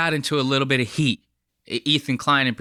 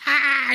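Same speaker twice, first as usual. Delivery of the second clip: mock scream; 13 semitones higher than usual.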